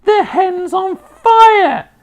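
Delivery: piercing, very high drawn-out shriek; loud old-woman screech, stretched out; Grandma's alarmed yell. A narrator's reading. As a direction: scream the alarm from far off. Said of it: "Grandma yelled".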